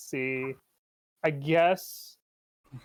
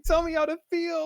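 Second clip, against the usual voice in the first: dramatic plea; 12 semitones higher than usual. Pretend. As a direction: cry out to the TV for answers. Chanting plea to South Park.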